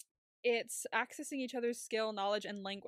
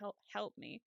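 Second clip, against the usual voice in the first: soft imitation of a drugged cry for help; a weak, understated mock plea; dazed plea; 6 dB quieter than usual. Medicated Nomi asking Will for help, dazed. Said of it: "like, dazed".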